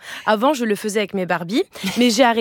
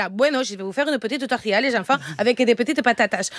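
put-on light Spanish accent for the kids. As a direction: cook for the children in Spanish style. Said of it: "avec l'accent". (accent espagnol en faisant la cuisine aux enfants)

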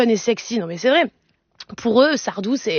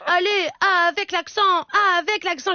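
put-on high, chanting kids' plea; children demanding; 7 semitones higher than usual. Children urging their mother to do the accent, high pleading. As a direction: beg like excited children, pitched up. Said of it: "mes enfants, ils étaient là".

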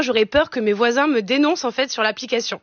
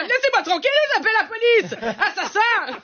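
high-pitched comic panicked outcry; hysterical panic; 8 semitones higher than usual. Acted. Terrified customer threatening courier with police, high hysterical cries. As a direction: cry for help, high and frantic. Acted panic at the delivery driver.

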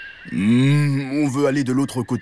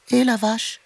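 much higher tiny kitten call; little kitten voice; 14 semitones higher than usual. Acted. Kitten calls the cow from behind, high little voice. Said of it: "une petite voix derrière elle".

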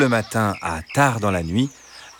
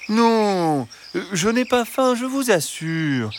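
reassuring fox; 11 semitones higher than usual. Acted. Fox assuring the hens he is not hungry, sweetly. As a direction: soothe them falsely.

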